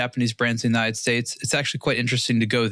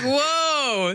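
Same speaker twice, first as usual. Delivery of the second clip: high, bright-eyed whoa of wonder; awed exclamation; amazed whoa; 12 semitones higher than usual. Real, not acted. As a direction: gasp it out like seeing a rainbow wall. Amazed by rainbow of watch displays.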